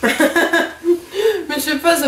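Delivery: laughing. Real, not acted. Laughing while eating candy.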